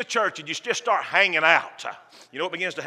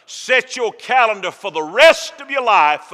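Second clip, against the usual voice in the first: forceful exhortation; 4 semitones higher, 8 dB louder than usual. Real, not acted. Preacher exhorting congregation.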